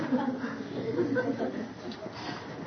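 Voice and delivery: airy, high, almost silent laugh; breathy high giggle. Real, not acted. Preacher giggling after joking about needing gum. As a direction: giggle at your own joke.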